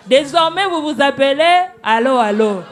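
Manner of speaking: amused proclamation. Real, not acted. Judge renaming the duo "allô allô", loud and laughing. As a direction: proclaim it loudly through amusement.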